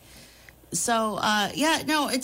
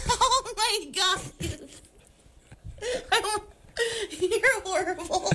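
laughing through the words, much higher; 10 semitones higher than usual. Real, not acted. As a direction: crack up at the joke. Laughing at co-host's joke.

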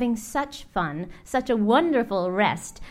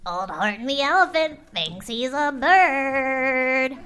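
mocking; 4 semitones higher, 3 dB louder than usual. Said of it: "They laughed and they laughed".

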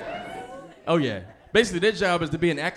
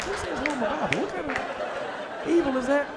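incredulous aside; 9 semitones higher, 4 dB quieter than usual. Retold or imitated. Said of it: "I'm like".

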